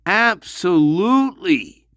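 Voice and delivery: ordinary talk, nothing marked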